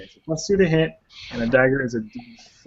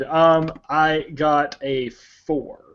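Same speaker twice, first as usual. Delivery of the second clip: usual speaking voice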